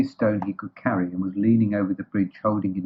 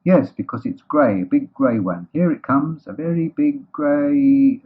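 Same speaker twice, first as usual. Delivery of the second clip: slow, raised, drawn-out anticipation; building excitement; 5 semitones higher, 5 dB louder, slower than usual. Acted. Pooh watching the grey shape approach, mounting excitement. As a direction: build up, stretching the last words.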